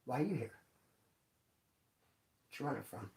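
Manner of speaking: inner voice. Retold or imitated. Asking the coworker silently in his head, hushed and low. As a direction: keep it in your head, barely voiced. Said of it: "But in my head".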